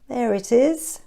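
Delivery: plain reading voice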